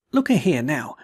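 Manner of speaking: ordinary delivery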